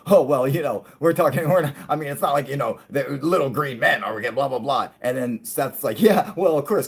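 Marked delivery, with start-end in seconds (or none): 0.1-4.9 dismissive scientist. Retold or imitated. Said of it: "the other astrophysicist is like". 6.0-6.9 eager agreement. Retold or imitated. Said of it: "'cause he wants to join in with him".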